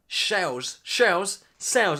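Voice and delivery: "shells" slurred with sh for s, then "sells" fixed; stumbling "shells, shells" into "sells"; tongue slip. Real, not acted. Tripping over sells, slurring s into sh. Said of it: "I've done, gone Dutch again".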